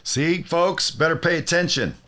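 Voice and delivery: high-pitched heads-up to listeners; rally the audience with a lifted voice; calling out. Real, not acted. Host telling listeners not to miss this, calling out.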